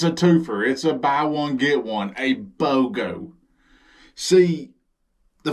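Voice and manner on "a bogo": ordinary delivery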